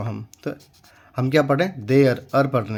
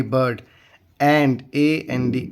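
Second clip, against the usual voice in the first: ordinary talk, nothing marked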